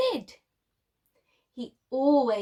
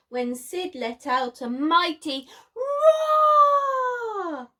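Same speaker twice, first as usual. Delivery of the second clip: slow, loud, higher booming line; mighty roar; 6 semitones higher, 7 dB louder, slower than usual. A narrator's reading. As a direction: roar it out for the children. Narrating Sid's mighty roar.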